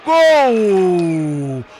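long drawn-out goal scream; goal call. Real, not acted. Goal scored in penalty shootout.

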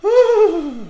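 high, loud bracing sigh; heavy sigh. Real, not acted. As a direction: sigh before diving in. Bracing to eat chip.

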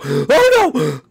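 high, loud triumphant yells; ecstatic cheering. Real, not acted. Gamer cheering at escaping the animatronic, shrieking yes. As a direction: scream with relief and joy.

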